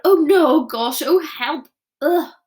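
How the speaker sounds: loud, spluttering disgust. A narrator's reading. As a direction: splutter it out in horror. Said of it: "she spluttered".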